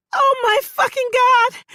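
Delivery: high, loud panicked shout. Acted. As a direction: scream it in shock. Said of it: "she shouts".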